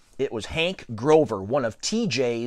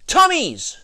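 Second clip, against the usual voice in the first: high-pitched silly holler; 4 dB louder than usual. A narrator's reading. Alex answering the coin toss, hollered. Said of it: "I hollered".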